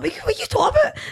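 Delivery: high, squeaky, laughing disbelief; mock outrage at a daft question; baffled disbelief. Mimicking his reply to his castmate's vol-au-vent chat, high and laughing. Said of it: "And I was like".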